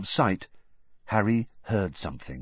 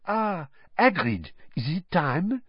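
put-on high, slow French-accented lady; French headmistress; 8 semitones higher than usual. A narrator's reading. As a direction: greet him in a high, lilting French accent. French headmistress greets Hagrid in story.

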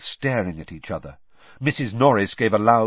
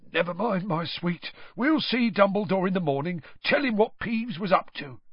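muttering; 9 semitones higher than usual. A narrator's reading. Filch to Missus Norris, high muttered cooing. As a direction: coo at the cat in a high voice. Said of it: "muttering to Missus Norris".

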